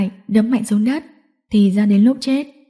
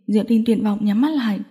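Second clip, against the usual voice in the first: plain reading voice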